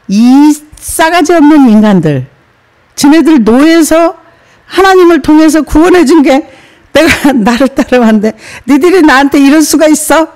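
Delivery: hypothetical angry Moses, half laughing; mock scolding. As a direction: play the insulted leader telling them off. Imagined Moses scolding people.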